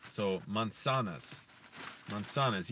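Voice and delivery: manzanas with an s, never a z: mahn-sah-nahs; Spanish s sound. Shown as a demonstration. Learner explaining the Spanish z, saying manzanas with an s. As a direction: manzanas said as man-SA-nas. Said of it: "say it almost like an S".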